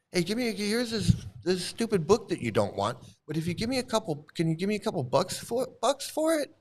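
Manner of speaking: high, whiny wheedle rising at the end; sarcastic impression of a pushy seller; mocking book seller. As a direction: beg for a couple bucks like a pest. Mock book seller asking for cash.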